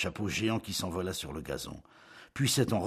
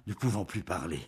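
plain reading voice

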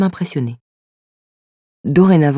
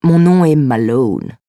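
put-on slow, drawn-out English accent; hammed-up English-accented French; 2 semitones lower, slower than usual. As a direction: introduce yourself grandly in an English accent. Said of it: "Je recommence avec l'accent anglais".

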